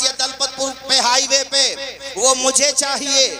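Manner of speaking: high, loud fervent sermon cry; impassioned preaching. Acted. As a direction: pour out the rebuke to the crowd. Sermon climax on parents. (جوشیلی بلند خطابت)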